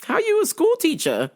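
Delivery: high mock indignant question; mock outrage. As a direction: act scandalized.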